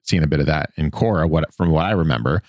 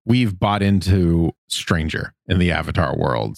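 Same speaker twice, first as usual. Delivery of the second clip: ordinary delivery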